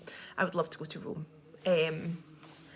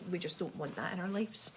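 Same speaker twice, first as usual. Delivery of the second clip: 1 hushed aside; 7 dB quieter than usual. Real, not acted. Mock-serious aside about frizz.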